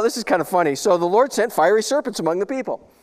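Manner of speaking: ordinary talk, nothing marked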